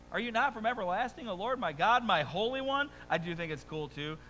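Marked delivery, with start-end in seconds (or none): 0.1-2.9 higher, rising questions; lighter prophet's plea. Acted. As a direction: ask in awe. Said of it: "this is Habakkuk's response".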